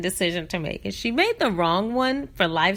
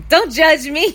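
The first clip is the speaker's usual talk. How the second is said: squeaky mock protest, cracking with laughter; laughing through a quote; 7 semitones higher, 8 dB louder than usual. Retelling the girl's offended reply, laughing. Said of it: "took offense and said".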